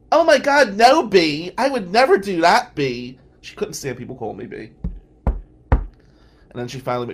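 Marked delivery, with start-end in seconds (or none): 0.1-3.2 much higher and louder, hammed-up innocence; mock-innocent denial. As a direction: gush it like fake innocence to tease him. Mock-innocent denial, teasing friend.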